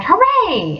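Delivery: high excited cheer; cried hooray. A narrator's reading. Rat finding something in the snow, excited cheer. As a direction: burst out with a find. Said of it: "Suddenly the Rat cried".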